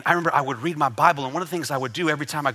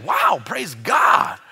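drawn-out shout of wonder; retold amazement; 3 dB louder, much slower than usual. Preacher reliving surprise that it worked, amazed shout. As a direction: stretch it out slowly in awed praise. Acting out his amazed praise.

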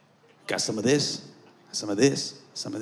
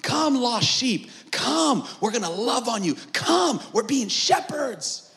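high, drawn-out call to the lost; shepherd calling out; 8 semitones higher, slower than usual. Acted. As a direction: call out high, stretching each phrase. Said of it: "We're saying".

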